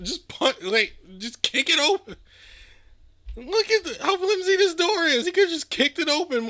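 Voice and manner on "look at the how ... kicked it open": amused disbelief. Real, not acted. Mocking flimsy door.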